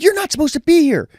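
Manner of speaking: high mock-scolding voice; imitating his boss telling him off. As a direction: nag like an annoyed supervisor. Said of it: "She's like".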